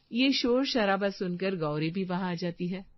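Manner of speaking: ordinary talk, nothing marked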